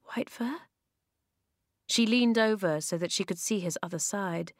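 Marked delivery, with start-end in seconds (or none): 0.1-0.6 soft anxious call. Acted. Calling the dying bear.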